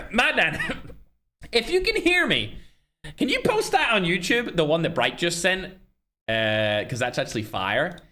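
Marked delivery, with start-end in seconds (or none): 0.1-5.7 high, raised calling voice. Real, not acted. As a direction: call out to someone out of earshot. Calling out to someone off-stream.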